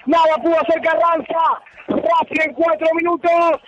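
excited goal commentary; breakneck goal commentary. Real, not acted. Excited post-goal play-by-play.

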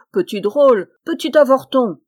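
loud, scornful insult; contemptuous. Acted. Giant scorning the tailor, loud contempt. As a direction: sneer down at the little man. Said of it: "d'un air de mépris".

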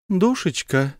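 high, sweet calling word; tender call. Acted. Wife calls her husband sweetly, high and tender. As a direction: coax him sweetly. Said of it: "ласково позвала".

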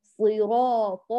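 صراط with a heavy, emphatic ط, slow and drawn out; صراط, ط pressed hard and full; strong emphatic ṭā. Shown as a demonstration. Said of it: "قوي بصفاته".

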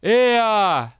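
loud, high shout across the ocean; bellowed call. A narrator's reading. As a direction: yell the name out over the water. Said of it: "как заорёт".

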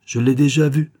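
high, loud, assured retort; brazen. A narrator's reading. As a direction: bluff with full confidence. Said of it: "répliquai-je impudemment".

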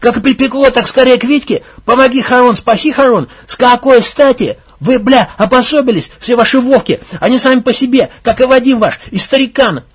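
loud, high furious shouting; raging rant. Acted. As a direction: rant at everyone. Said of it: "всё больше распаляясь бушевал".

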